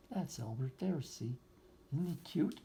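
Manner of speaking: ordinary delivery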